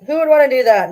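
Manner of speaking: loud indignant rhetorical question; emphatic. Real, not acted. Louder repeat.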